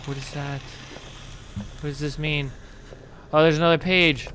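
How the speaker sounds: ordinary delivery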